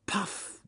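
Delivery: high, breathy unvoiced puff of effort. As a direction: blow it out as pure breath. Bear straining at the dam.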